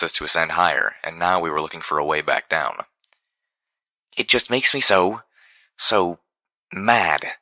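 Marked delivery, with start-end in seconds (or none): 4.1-7.4 angry outburst. A narrator's reading. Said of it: "burst out with a stomp".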